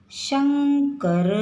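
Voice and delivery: teacher contrasting शकर with शंकर; slow stressed nasal demo. Shown as a demonstration. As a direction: lean on the ं in शंकर. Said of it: "अनुस्वार बिंदी आएगी".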